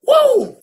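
high, loud whoop of excitement; excited woo. Real, not acted. Hobo host whooping to open his joke segment. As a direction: hoot like a hype man.

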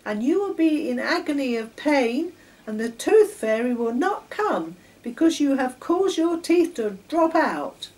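put-on high, loud, nagging little-girl voice; persistent. A narrator's reading. Girl nagging Charlie to brush, insistent. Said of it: "Patricia went on and on".

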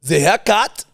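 high, loud mock accent sliding into French; French-sounding German attempt. Shown as a demonstration. Host prompting the haircut line, mock French-sounding accent. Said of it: "Oh, French".

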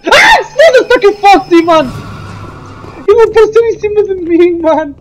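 high, loud terrified yelling; panicked scream. Real, not acted. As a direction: yell in sheer fright. Foxy jumps on him.

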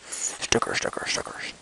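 gleeful whispered chant; softly chanted whisper. Real, not acted. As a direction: hush it like a secret treat. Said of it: "I'm talking a little quiet".

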